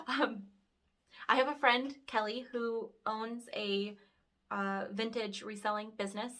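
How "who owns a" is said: ordinary talk, nothing marked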